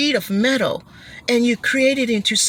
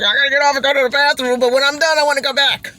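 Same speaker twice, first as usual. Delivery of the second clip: hurried eager rider; 5 semitones higher, 4 dB louder, faster than usual. Retold or imitated. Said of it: "some people's like".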